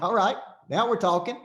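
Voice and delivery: now this is the real deal; excited approval. Retold or imitated. Reacting to big money.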